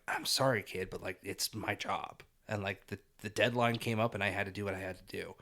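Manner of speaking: low, soft imagined apology; acting out a reluctant apology, voice dropped low; mildly remorseful. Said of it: "that little bit of remorse".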